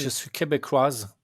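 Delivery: put-on slow French with an English accent. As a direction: imitate an anglophone saying québécoise. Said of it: "elle le dit avec un accent anglais".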